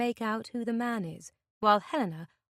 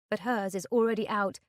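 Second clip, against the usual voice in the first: ordinary delivery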